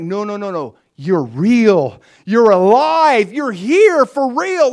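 fervent slow declaration; passionate insistence. Real, not acted. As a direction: drive it home with fervour. Insisting God is real.

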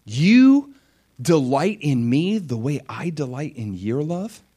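astonished. Pretend. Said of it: "I'm like".

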